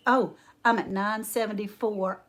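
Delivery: ordinary talk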